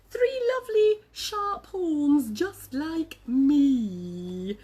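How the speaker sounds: high, slow storybook character; Triceratops questioning. Acted. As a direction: draw out each question to the egg. Triceratops addresses the egg.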